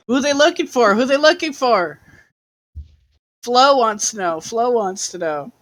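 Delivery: put-on high insistent child; pushy little kid voice. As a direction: demand it like a nosy kid. Child Flo demanding to know.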